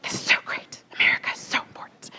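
mock breathy stage whisper, quick and hushed. Hillary stage-whispering her passion to the rally crowd, mock whisper. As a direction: whisper it like a big secret that matters. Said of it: "weird whispering thing where she was like, ... stage whispering".

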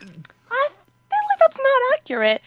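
high, drawn-out doubtful squeak; hesitant objection. Retold or imitated. Traveler correcting the inspector's claim, high hesitant objection.

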